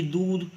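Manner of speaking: दूध pitched high with a drawn-out ऊ; teacher's emphatic दूध; stress on दूध. Shown as a demonstration. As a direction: put weight on the long vowel of दूध. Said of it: "थोड़ा बल देकर बोलना पड़ता है".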